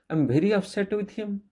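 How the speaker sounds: high-pitched upset exclamation, as a demo; strong emotion. Shown as a demonstration. Teacher showing children an exclamation mark for strong emotion, upset and high. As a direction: demonstrate being very upset, pitched well up. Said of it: "to show strong emotion".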